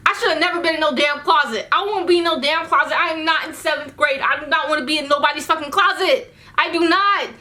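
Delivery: worked-up, high-pitched tirade; indignant outburst. Acted. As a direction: protest loudly, hitting each refusal. Indignant rant about being in closet.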